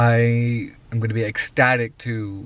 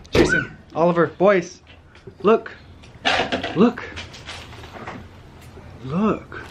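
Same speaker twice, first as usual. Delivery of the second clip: calling out excitedly; 6 semitones higher, slower than usual. Real, not acted. Calling kids to see slime.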